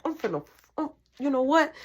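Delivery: usual speaking voice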